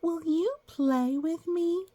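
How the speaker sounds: high, slow, sweet asking; childlike asking. A narrator's reading. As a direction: ask like a small hopeful child. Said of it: "I said".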